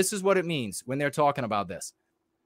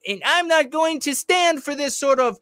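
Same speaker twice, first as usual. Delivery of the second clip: much higher, louder pompous outrage voice; mock posturing senator; 11 semitones higher, 4 dB louder than usual. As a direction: ham up a grandstanding senator on camera. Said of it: "turn on my little microphone".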